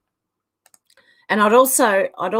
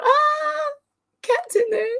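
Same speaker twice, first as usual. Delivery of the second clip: excited; slower than usual. Retold or imitated. Retold own thought, squealed.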